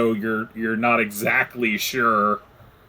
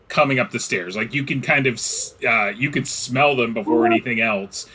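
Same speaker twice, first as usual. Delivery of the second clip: ordinary delivery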